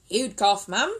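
loud, confident workman's verdict; knowing builder. A narrator's reading. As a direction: announce it like an old hand. Builder retorts.

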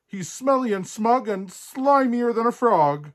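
high, loud, indignant rant; furious princess. A narrator's reading. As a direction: spit out the insults as an outraged princess. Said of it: "Princess Poppy was furious".

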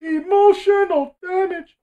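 high, loud, slow meme yell; meme shout. Retold or imitated. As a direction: belt it like the internet meme. Meme quote on dying.

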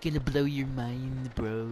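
slow, lightly drawled stoner imitation; lazy surfer-dude stoner. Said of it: "You sound like a fucking stoner".

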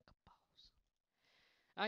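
whispered boast. Real, not acted. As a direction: breathe it out smugly. Whispered after "like a boss.

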